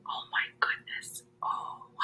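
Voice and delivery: breathy whispered dismay. Real, not acted. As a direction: whisper it in mock shock. Whispered aside.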